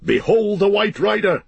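high, ringing hail; rallying cry. A narrator's reading. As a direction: proclaim it to the whole host. Aragorn hails the rider to all.

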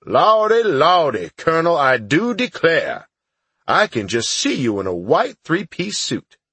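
mock Southern gentleman, teasing the new colonel in a Southern accent; ribbing Sarge in an exaggerated Southern accent; heavy Southern accent. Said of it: "with a heavy Southern accent".

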